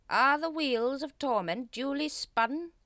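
high, loud, slow ritual intonation; stretched-out pompous ceremonial call; ceremonial Grand Master. A narrator's reading. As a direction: intone it grandly as cult leader. Grand Master leads secret ritual.

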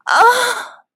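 sudden high-pitched "ah"; loud wordless outcry; high loud cry. Acted. Character cries out in narrated scene.

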